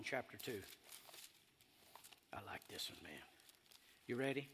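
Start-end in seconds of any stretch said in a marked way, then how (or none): 2.3-3.2 whisper. Real, not acted. Aside about favourite verse.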